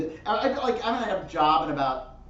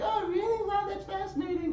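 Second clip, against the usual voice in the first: squeaky high fake interest; high mock-fascinated coworker. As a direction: pitch way up, gushing mock wonder.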